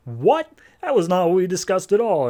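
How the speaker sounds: a mock outraged protest; indignant surprise. [name] objecting to the sudden surrender, an indignant outburst.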